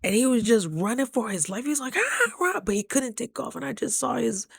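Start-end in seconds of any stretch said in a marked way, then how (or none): 2.0-2.6 high panicked squawk; mock goose squawk, pitched high.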